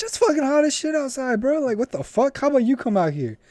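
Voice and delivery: heated high rant; fired-up rant. Real, not acted. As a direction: rant at the coworkers inside. Ranting about heat.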